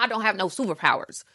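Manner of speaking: ordinary talk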